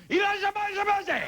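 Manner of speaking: high shouted greeting; sushi chef's shout. Retold or imitated. Sushi chefs greeting a customer, high mock shout. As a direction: bellow the welcome like a sushi chef. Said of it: "they shout at you".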